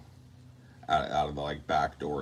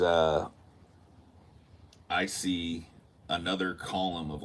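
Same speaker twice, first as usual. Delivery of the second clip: ordinary delivery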